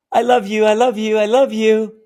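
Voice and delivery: put-on very high, loud, urgent child's plea; fawning child. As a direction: frantically placate the monster in a little boy's voice. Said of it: "he's fawning".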